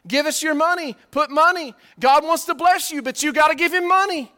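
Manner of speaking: high, loud mock of a begging preacher; mocking greedy preacher. Preacher mimics televangelists begging the congregation, in a loud, high mock voice. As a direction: ham up a preacher pleading for cash. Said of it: "all they ever talk about is money".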